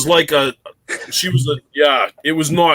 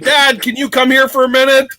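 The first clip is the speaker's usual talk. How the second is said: daughter calling; 7 semitones higher than usual. Retold or imitated. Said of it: "She's like".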